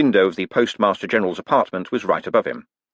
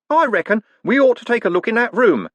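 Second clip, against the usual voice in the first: stubborn hostler; 14 semitones higher, 4 dB louder than usual. Acted. Hostler insists.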